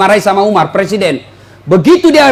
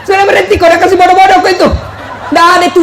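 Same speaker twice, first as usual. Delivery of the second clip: mock scolding shout; 6 semitones higher, much faster than usual. Scolding the husband again. (tegur dengan keras, cepat, sambil tertawa)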